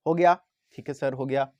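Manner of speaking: ordinary delivery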